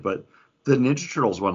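ordinary talk, nothing marked

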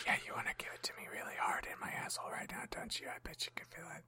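breathy fast whisper; ASMR whisper. Pretend. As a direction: whisper it close to the mic. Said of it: "are we doing an A S M R episode again?".

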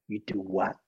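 put-on low, soft American accent, puzzled; a hushed, doubting Clint; Clint Eastwood voice. Clint Eastwood puzzled by the bodyguard's answer, low soft accent. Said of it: "in that accent".

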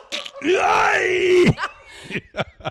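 loud high laugh. Real, not acted. Comic picturing the realtor's sign, howling with laughter. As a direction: burst of high shrieking laughter.

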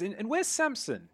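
high surprised question; Gladius asking. Acted. Gladius asking the crew where Samson is, high and surprised. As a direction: lift it up, suddenly worried.

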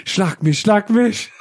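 hammed-up dirty talk; mock begging. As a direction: beg jokingly, rising into laughter. Mock begging in sexual joke.

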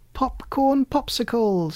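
high, slow delighted squeal. A narrator's reading. Kitten showing her invention, squealing. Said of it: "she squealed".

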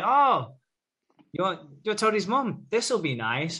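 high-pitched, imitated greeting; mock pleasant surprise. Mel meeting Toadie's mum, mock pleasant surprise. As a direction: act out a high "oh" on meeting someone.

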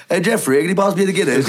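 put-on posh accent. Rugby fan asking a friend for Guinness, mock posh accent. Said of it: "sorry to go all posh on you".

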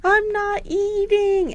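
high, drawn-out mock wail; Harriet. Recapper as Harriet refusing the diet, hammed-up crying. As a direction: whine it slowly, crying for show. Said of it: "Harriet starts crying".

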